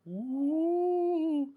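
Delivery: long, high, held ghostly "ooh"; spooky ghost ooh. Pretend. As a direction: make a mock-spooky wail. Spooky reaction to ghost story title.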